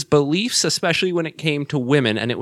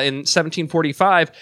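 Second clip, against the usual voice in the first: ordinary talk, nothing marked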